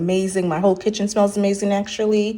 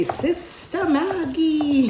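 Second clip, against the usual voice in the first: very slow, drawn-out, higher sing-song Sister Maggie; imitating Daddy's Kitchen; 9 semitones higher, much slower than usual. Fan quoting a cooking host's catchphrase, slow and high. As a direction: stretch each syllable of Maggie lovingly. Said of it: "I like whenever he would say".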